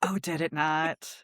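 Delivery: ordinary delivery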